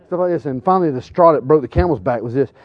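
ordinary talk

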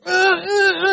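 high imitated whimpering cries; child's frustrated cries. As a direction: mimic a kid crying over a knot. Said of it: "he's just screaming".

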